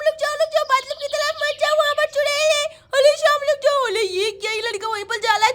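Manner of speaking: put-on high, lisping child's warning; urgent little girl. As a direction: call anxiously after a playmate. Sonam urges Shyam to stop. (घबराई बच्ची की तोतली पुकार)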